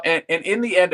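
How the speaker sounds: ordinary delivery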